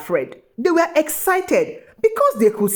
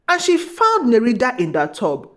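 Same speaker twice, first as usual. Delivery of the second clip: plain reading voice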